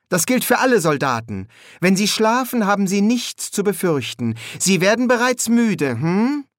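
put-on high child's voice, insistent suggestion; boy playing hypnotist. A narrator's reading. Adam talks the soldiers into sleep, boy hypnotist. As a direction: coax them to sleep in a high young voice.

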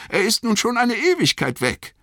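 very high-pitched hobbit complaint; high cry. A narrator's reading. Pippin worries that Frodo is long gone, crying out. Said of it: "rief Pippin".

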